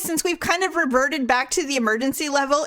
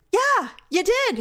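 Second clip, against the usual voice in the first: pointed reply; 10 semitones higher than usual. Retold or imitated. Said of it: "I'm like".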